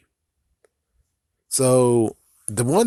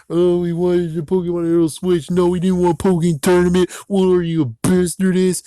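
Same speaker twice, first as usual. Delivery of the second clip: higher, louder mocking fan voice; mock whiny fans; 7 semitones higher, 4 dB louder than usual. Vlogger mimicking angry Pokémon fans, whiny mockery. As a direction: sneer at the complaining fans. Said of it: "It's like".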